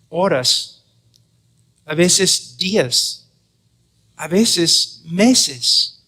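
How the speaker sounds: slow, stretched "días" and "meses"; drawn-out emphasis. Real, not acted. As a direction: draw out each length of time to make it feel long. Stressing how long evangelism takes.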